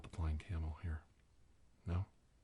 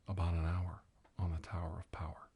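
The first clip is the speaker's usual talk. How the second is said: ordinary delivery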